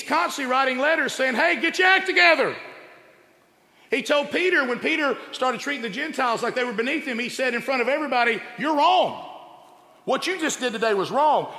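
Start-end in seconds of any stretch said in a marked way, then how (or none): 1.3-2.6 a sharp call to shape up, much louder and higher; loud rebuke. Retold or imitated. Paul scolding the churches, loud and high. 8.6-11.5 stern rebuke. Retold or imitated. Paul rebuking Peter publicly.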